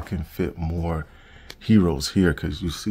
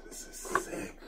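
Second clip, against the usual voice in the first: hushed whisper; 13 dB quieter than usual. Real, not acted. Admiring the lights up close.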